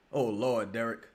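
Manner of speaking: ordinary delivery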